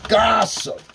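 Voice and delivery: "gossip" high and loud on its own; "gossip" in bold letters; emphasized GOSSIP. Shown as a demonstration. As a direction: throw out "gossip" as a loud, high-pitched punch. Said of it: "let me emphasize the word".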